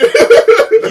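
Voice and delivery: a sudden high, loud burst of laughter. Real, not acted. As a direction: crack up out of nowhere. Bursts out laughing mid-argument.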